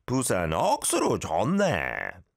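fast, punchy Busan exclamation; Busan accent. Shown as a demonstration. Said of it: "we gotta try to do it with a Busan accent".

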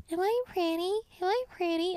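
high, eerie sing-song copy; mimic copying her voice. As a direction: sound like a lure mimicking her. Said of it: "So it can mimic my voice".

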